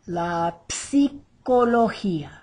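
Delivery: slow demo of "psicología", P sounded before the S; P-S-I together. Shown as a demonstration. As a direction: model "psicología" with a popped P. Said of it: "Let's try it one more time".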